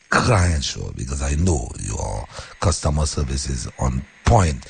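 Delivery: usual speaking voice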